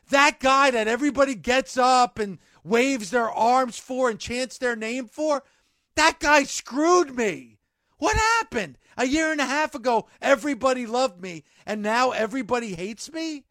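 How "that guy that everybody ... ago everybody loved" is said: rising to an indignant yell, higher and louder; angry promo shout. Acted. Wrestler scolding the crowd that boos him, indignant shout. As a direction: hurl it at the crowd who betrayed you.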